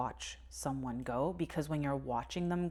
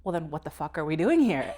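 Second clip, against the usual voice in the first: louder, incredulous grandpa retort; mock exasperated retort; 8 dB louder than usual. Grandfather snapping at grandchild in hospital, louder exasperated retort. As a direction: throw it back like a fed-up grandfather.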